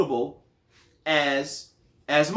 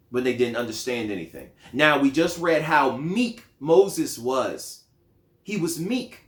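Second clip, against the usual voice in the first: ordinary talk, nothing marked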